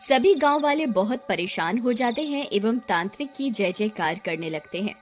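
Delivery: ordinary delivery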